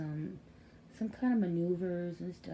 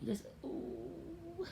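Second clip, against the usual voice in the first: a high, soft, slow coo of bliss; dreamy coo; 10 semitones higher, 8 dB quieter, much slower than usual. Retold or imitated. Mother describing cuddling her baby, soft high blissful coo. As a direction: melt into a long, quiet ooh.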